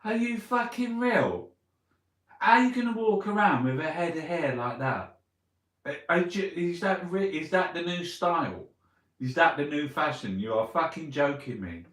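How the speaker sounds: loud, high-pitched disbelief; incredulous ranting. Real, not acted. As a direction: rant in outraged amazement at a ridiculous sight. Ranting at someone's ridiculous hair.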